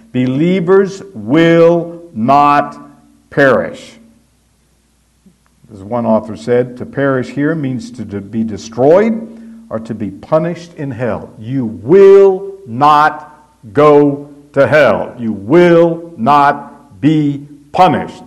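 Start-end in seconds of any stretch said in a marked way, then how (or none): 0.1-4.0 slow, loud "will not"; emphatic will not. Shown as a demonstration. Preacher hammering the double negative, slow and emphatic. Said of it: "most emphatic statements". 11.4-18.3 slow, loud, each word punched; emphatic promise. Shown as a demonstration. Preacher assuring the flock, slow and pounding. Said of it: "That is emphatic".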